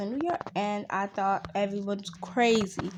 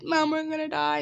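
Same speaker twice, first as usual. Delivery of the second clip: higher, mildly panicked cry; retold panic; 7 semitones higher than usual. Kid to mom when lights went off, panicking. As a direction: act out a child's panic lightly. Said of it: "I started panicking and said".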